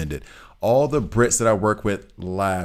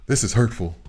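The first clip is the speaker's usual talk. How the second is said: mock offended; 3 semitones higher than usual. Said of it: "they were like".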